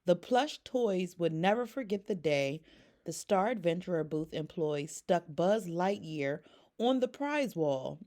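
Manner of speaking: usual speaking voice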